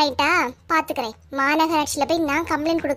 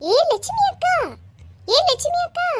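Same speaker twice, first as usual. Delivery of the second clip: high, carrying call across the yard; shrill summons at the door; 12 semitones higher than usual. Acted. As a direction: yell for her neighbour from outside. Neighbour calls loudly from outside.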